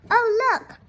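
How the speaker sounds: high, loud delighted oh look; excited. Real, not acted. Teacher showing the children the family's house, excited. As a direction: gasp at the picture.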